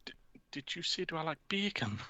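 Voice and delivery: a hushed, lost repeat in a put-on high voice; Steve Bruce impression. Steve Bruce snapping back to reality, hushed and confused. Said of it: "snaps back to reality and he's like".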